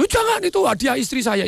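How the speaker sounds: sudden high-pitched plea; acted alarm. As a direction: protest urgently to save a gift. Victim pleads for late wife's gift. (pura-pura kaget memohon, nada tinggi)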